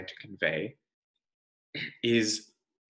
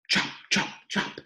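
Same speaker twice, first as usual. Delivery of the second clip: chop sound. Acted. Hatchet sound.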